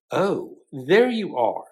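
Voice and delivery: slow, fond greeting to the cat; lightly voiced Bloom. Bloom greets his cat by the fire, lightly voiced. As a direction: greet the cat as if glad to see her. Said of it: "Mister Bloom said".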